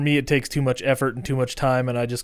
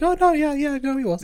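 high mock voice, much higher, dismissive; mocking impression. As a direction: brush it off in a mocking squeaky tone. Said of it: "And he's like".

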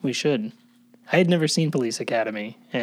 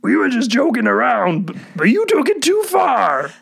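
put-on much higher, loud slurred drunk whine; Barney Gumble; 11 semitones higher, 7 dB louder than usual. Doing his turn, he whines loud as a drunk cartoon barfly. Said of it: "That was Barney Gumble".

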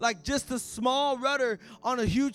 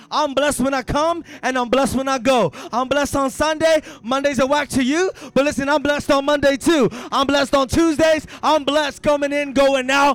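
louder, driving sermon cadence; full-force preaching; 4 semitones higher, 12 dB louder than usual. Acted. Preacher rouses the congregation about being blessed, full-force preaching. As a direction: hammer each "I'm blessed" home.

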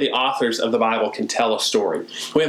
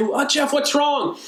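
much higher, alarmed asking what's wrong; concerned friend; 12 semitones higher than usual. Pretend. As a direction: play the worried friend rushing over. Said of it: "And you say".

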